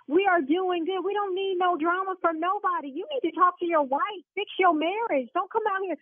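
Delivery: indignant. Real, not acted. Owner refuses his threat.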